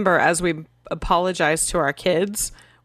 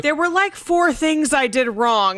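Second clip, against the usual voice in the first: put-on flustered child; 5 semitones higher, 4 dB louder than usual. Mother voicing her child who can't pick which wrong to apologize for, hammed-up and high. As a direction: play the kid lost among her own mistakes. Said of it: "they're like".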